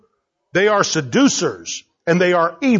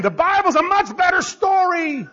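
exasperated outburst; 10 semitones higher than usual. Retold or imitated. Preacher retelling leaving the Noah movie, exasperated cry. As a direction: blurt it out walking away. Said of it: "walking out saying".

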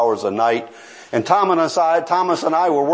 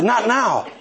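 higher, disbelieving repeat of Not now; preacher repeating the friend's refusal, higher and incredulous; incredulous echo; 7 semitones higher than usual. Retold or imitated. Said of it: "I said".

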